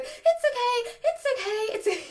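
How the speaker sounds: high, urgent, repeated panicky soothing; squeaky-high owner fretting at the dog; panicked reassurance. Shown as a demonstration. Owner trying to calm her puppy, panicked and high. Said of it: "because I'm a little bit panicked too".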